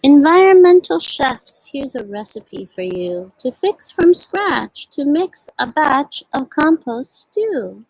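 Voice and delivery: slow, sing-song picture-book reading; reading to children. Acted. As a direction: read each ingredient slowly, savoring the rhyme. Reads picture book aloud.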